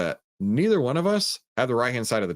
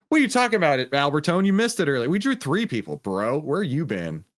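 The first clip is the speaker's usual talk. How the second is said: higher and louder, playfully indignant; excited teasing; 4 semitones higher, 3 dB louder than usual. Real, not acted. Streamer teasing chatter Albertone for missing it, loud and excited. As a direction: rib him for missing the big moment.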